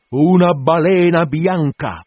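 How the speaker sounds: loud, rising call to the crew; captain rousing his sailors; shouted orders. Acted.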